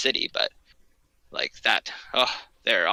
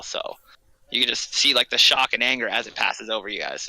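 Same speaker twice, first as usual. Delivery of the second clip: normal talking voice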